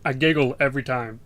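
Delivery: ordinary talk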